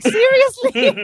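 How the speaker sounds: high, loud, slow disbelief; incredulous seriously. Retold or imitated. Mocking a dramatic Spanish show title, incredulous. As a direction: stretch it out in mock disbelief. Reacting to telenovela name.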